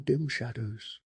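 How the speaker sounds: ordinary delivery, nothing marked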